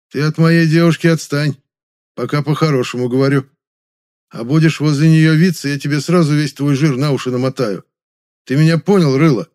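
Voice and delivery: loud, drawled thug threat; russian prison slang. A narrator's reading. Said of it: "растягивая слова на блатной манер".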